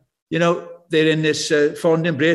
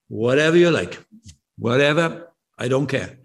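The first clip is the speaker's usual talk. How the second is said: indifferent; 6 semitones lower than usual. Retold or imitated. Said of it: "my answer is".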